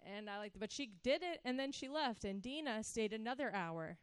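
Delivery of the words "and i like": painstaking reading. Retold or imitated. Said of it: "it was painstakingly".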